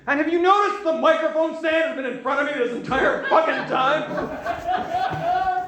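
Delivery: high, exasperated rant; comic outburst. Real, not acted. Comedian complaining to the audience about the microphone stand, exasperated outburst. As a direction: raise the pitch and rant in disbelief.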